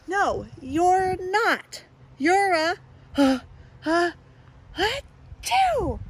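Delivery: slow, loud, high scolding; sputtering to find the word; loud halting mock scold; loud stammering bird.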